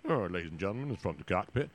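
low, soft Deep South drawl over the intercom; lazy Southern airline captain; a Southern pilot's easy drawl into the mic. Retold or imitated. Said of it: "sound like they're from the Deep South".

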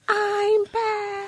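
very high squeal of joy; joyful squeal. Real, not acted. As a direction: squeal it with delight. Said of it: "Do you hear the smile in my voice".

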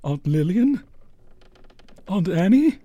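nervous call, rising at each name; tentative calling. Acted. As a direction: call warily into the dark. Calls into the dark house.

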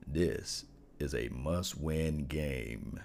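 low, slow, heavy delivery; deep voice. Shown as a demonstration. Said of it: "I'm gonna get into my deepest voice".

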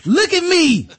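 high, loud, slow taunt; menacing gloat. Retold or imitated. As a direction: lord it over the food. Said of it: "and be like".

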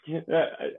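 laughing. Real, not acted. Explaining joke while still laughing.

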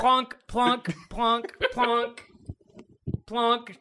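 high-pitched plonking sound effect; spaced-out mock thuds, high in the voice; imitated plonks. Imitates glasses being plonked down.

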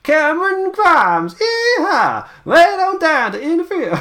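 high, slow Southern twang, hollering yeehaw; Cameron Grimes accent. Retold or imitated. Podcaster mimics the wrestler's Southern accent. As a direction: ham up a Southern drawl with a yeehaw. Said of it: "I get to do my Grimes accent a lot".

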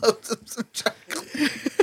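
slow, laughing repeat; giggling through the words. Real, not acted.

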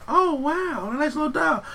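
put-on high, sweet little girl voice. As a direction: imitate a delighted little girl. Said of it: "the little girl goes up to her".

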